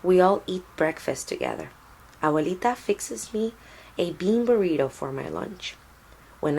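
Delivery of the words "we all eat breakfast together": ordinary delivery, nothing marked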